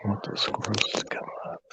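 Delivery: soft hunting whisper. Real, not acted. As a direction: whisper so the squirrels don't hear. Whispering while hunting, waiting quietly.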